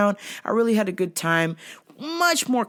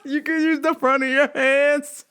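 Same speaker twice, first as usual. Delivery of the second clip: giddy mock flirting; 7 semitones higher than usual. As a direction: squeal the invitation while cracking up. Giddy punchline amid laughter.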